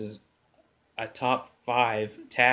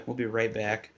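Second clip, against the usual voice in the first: normal talking voice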